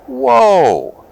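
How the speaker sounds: high, loud whoa of wonder; thrilled whoa. Acted. Squirrel glides from the tree, shouting with delight. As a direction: ride the thrill of flying. Squirrel gliding through the air.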